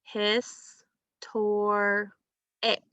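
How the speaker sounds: "Hiss, tor, ick" in three separate beats; teacher counting out "Hiss, tor, ick"; syllable by syllable. Shown as a demonstration. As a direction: pause cleanly between "Hiss", "tor" and "ick". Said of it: "see what our third syllable was".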